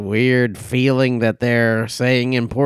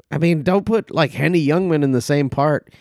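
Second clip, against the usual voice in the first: ordinary talk, nothing marked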